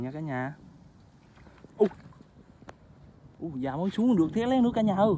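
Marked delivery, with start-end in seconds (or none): 1.8-5.1 excited. Real, not acted. Thrilled at another frog.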